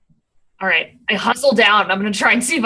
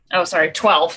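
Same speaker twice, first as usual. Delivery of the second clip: usual speaking voice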